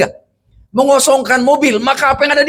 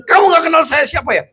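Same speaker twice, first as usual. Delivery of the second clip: raised, higher-pitched bullying challenge; mock angry driver; 6 semitones higher than usual. Preacher retells rude driver after crash, mock furious. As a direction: throw it at him like an arrogant driver who hit you. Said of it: "galaknya luar biasa".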